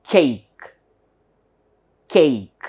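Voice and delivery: CAKE as one long falling syllable; demonstrated pronunciation. Shown as a demonstration. Teacher models cake for learners, demonstrated pronunciation. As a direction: say "cake" in one drawn-out stroke. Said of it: "we have to pronounce it as".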